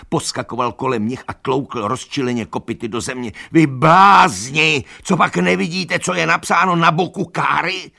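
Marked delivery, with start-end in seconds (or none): 3.5-7.9 donkey raging at the blind animals; furious shout. A narrator's reading. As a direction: drag out the scorn. Benjamin yells at the animals.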